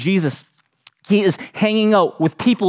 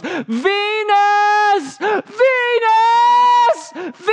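put-on much higher, crying child's scream of a name; lost child screaming; much slower than usual. Lost boy in the grocery store screams for his babysitter, much higher and crying. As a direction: shriek for the missing babysitter like a panicked four-year-old. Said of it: "I start screaming at the top of my lungs".

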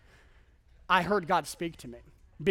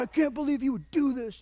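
higher-pitched, whiny complaint to God; mock sulky outrage; 6 semitones higher than usual. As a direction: pout it out like a wronged teen. Sulking in car, mad at God.

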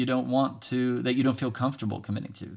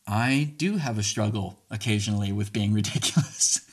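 breaking into laughter. Real, not acted. Speaker admits being ridiculous, unable to keep from laughing. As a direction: model answer cracked by a laugh. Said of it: "Hopefully you can say it without laughing".

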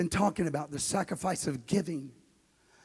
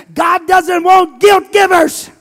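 higher, louder, slow and emphatic; shouted declaration; 12 semitones higher, 20 dB louder, slower than usual. Acted. Pastor thundering at his congregation, slow, high and loud. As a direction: hammer it home to the whole room. Climactic shouted preaching line.

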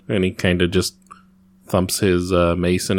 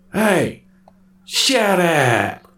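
rough mock threat; 8 semitones higher, much slower than usual. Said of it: "use his persuasion and say".

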